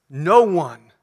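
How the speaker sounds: "No one" punched loud; emphasized. Shown as a demonstration. Preacher stressing "No one" to the congregation, loud. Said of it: "No one is the word that's emphasized".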